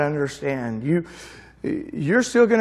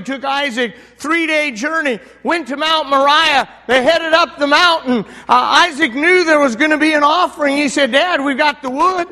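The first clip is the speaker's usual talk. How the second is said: higher, louder revival preaching; fiery pulpit storytelling; full-force preaching; 8 semitones higher, 6 dB louder than usual. Acted. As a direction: drive the story home to the congregation.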